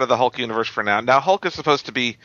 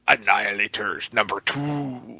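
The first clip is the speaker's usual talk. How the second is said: slow booming announcer voice; monster truck ad; 3 semitones lower, 4 dB quieter, slower than usual. Retold or imitated. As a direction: hype it like a monster truck rally. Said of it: "he sounds like a monster truck announcer".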